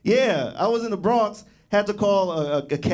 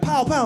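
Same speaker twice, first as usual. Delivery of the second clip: gunshots; 9 semitones higher than usual. Retold or imitated. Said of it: "It would be like".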